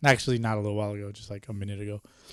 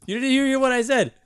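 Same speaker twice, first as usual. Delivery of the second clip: teasing; 13 semitones higher, 6 dB louder than usual. Real, not acted. Teasing after a poor word choice.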